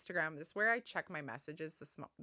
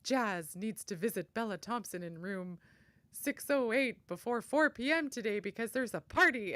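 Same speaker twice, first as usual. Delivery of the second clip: bossy pretend mayor; 4 semitones higher, 6 dB louder than usual. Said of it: "pretend she was the mayor".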